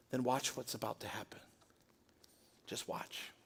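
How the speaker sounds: soft, hushed, sinking to a breath; a quiet confident hush; hushed promise. Retold or imitated. Jesus answers softly, acted quote.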